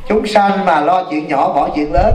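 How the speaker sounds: slow, deliberate sermon title; slow quoted title. Retold or imitated. Monk recalls his last sermon title to the audience, slow and deliberate. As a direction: weigh every word of the teaching.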